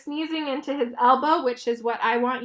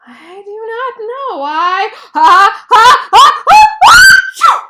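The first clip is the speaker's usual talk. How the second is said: sneeze build-up; 8 semitones higher, 18 dB louder than usual. A narrator's reading. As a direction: wind up and explode into a sneeze. Gerald sneezes again.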